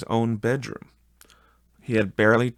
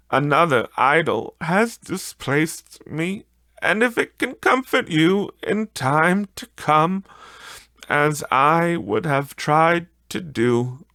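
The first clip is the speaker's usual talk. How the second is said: put-on higher, a gently grieving young woman; sorrowful young woman; 8 semitones higher, 5 dB louder than usual. A fiancée releasing Scrooge, higher and grieving.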